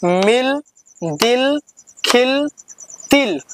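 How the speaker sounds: slow careful teacher drill; slow reading drill. Shown as a demonstration. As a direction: read each word clearly for the class. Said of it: "चलो पढ़ते हैं".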